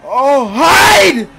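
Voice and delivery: high, loud cries; panicked yelling in real fear; terrified screams. Real, not acted. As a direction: shriek and shout to hide. Screaming while fleeing.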